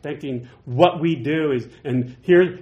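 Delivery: ordinary talk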